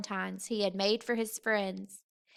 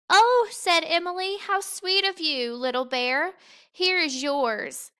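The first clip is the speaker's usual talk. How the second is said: put-on sweet girl voice; 6 semitones higher, 5 dB louder than usual. A narrator's reading. Emily voiced in story.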